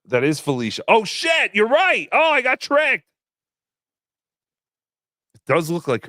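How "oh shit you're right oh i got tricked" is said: higher, louder sudden realization; real surprise, pitch jumping up; caught out. Real, not acted. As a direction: exclaim it like you just got fooled. Realizes chat was right.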